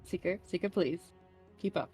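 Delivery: soft, urgent in-character pleading; hushed plea. Acted. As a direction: plead under your breath. In-character plea to lagging companion.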